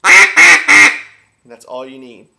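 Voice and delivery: loud slow quacking on a duck call; duck call honks. Shown as a demonstration. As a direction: blow three long quacks on the call. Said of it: "you need a cadence of one, two, three".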